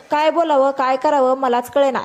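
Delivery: usual speaking voice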